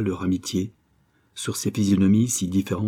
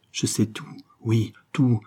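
usual speaking voice

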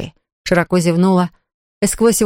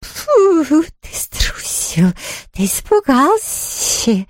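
high slow wail with a whispered middle; reproachful ghost; 8 semitones higher, much slower than usual. A narrator's reading. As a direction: whimper out the accusation. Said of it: "горестно вопил Хуга".

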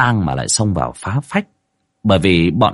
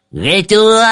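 high, rising gleeful taunt; snickering old medium; 12 semitones higher, 4 dB louder, slower than usual. A narrator's reading. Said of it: "lão đồng già mới tiến lên cười hì hì".

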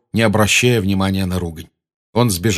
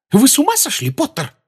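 higher, startled matron; startled cry; 3 dB louder than usual. A narrator's reading. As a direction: cry out in fright. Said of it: "вскрикнуть от испуга".

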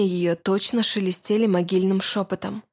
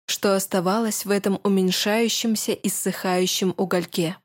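plain reading voice

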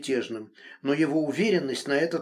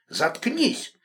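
angry shout; 9 semitones higher than usual. A narrator's reading. Enraged man silencing her.

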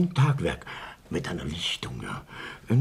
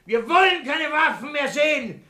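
passionate shouting; 12 semitones higher than usual. Acted.

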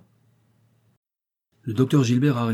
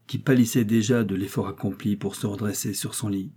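ordinary delivery, nothing marked

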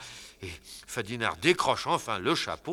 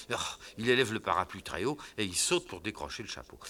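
usual speaking voice

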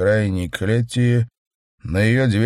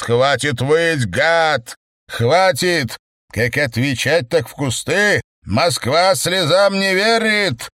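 angry shouting; 7 semitones higher, 3 dB louder than usual. Acted. Kedrin shakes the wailing chairman.

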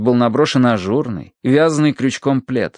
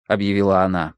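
plain reading voice

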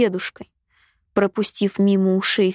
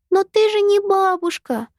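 put-on high, protesting child's voice; a small girl objecting stubbornly; little girl voice; 11 semitones higher, 3 dB louder than usual. A narrator's reading. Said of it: "а потом пробормотала".

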